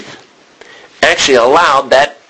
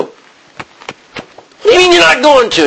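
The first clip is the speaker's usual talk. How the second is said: indignant disbelief; slower than usual. Retold or imitated. Bystander protests to the warden.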